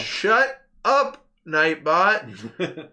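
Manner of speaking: high, loud shout at the bot; yelling at the spamming chat bot; annoyed yell. Real, not acted.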